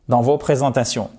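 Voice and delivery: ordinary talk, nothing marked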